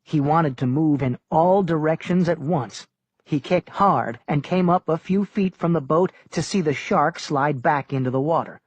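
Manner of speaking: plain reading voice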